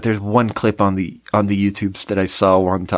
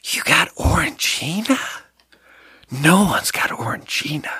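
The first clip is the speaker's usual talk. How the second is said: slightly higher, slow mock questioning; women's response; 5 semitones higher than usual. Women responding to the comedian's Orangina offer, mock incredulous. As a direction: imitate an incredulous date, drawing it out. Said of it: "the women always respond".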